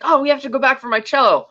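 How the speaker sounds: high-pitched put-on female voice; imitating insistent woman. Voicing the woman's plea.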